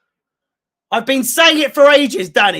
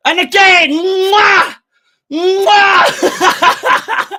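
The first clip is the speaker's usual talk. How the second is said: gleeful shout; 7 semitones higher, much slower than usual. Real, not acted. Fan blowing kisses after the comeback goal, gleeful shout. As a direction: shout big smacking kisses in celebration.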